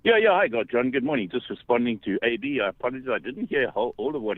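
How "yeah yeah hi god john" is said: ordinary talk, nothing marked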